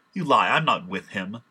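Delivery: loud, indignant acted denial; heated denial. Disciple denying his master to an accuser, loud and heated. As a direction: throw the accusation back loudly.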